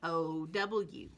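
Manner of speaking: ordinary talk, nothing marked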